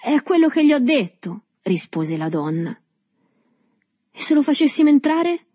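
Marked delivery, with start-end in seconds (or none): 0.0-1.4 high, raised woman's voice; guardiana che risponde. A narrator's reading. Woman backs the scolding man. 4.1-5.5 high, raised character voice; il biondo. A narrator's reading. Blond man suggests letting boy in.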